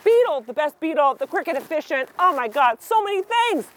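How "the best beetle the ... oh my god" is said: ordinary delivery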